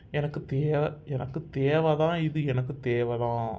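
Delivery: low, soft, resigned muttering; dejected self-blame. Acted. Bitterly blames himself after retelling warnings.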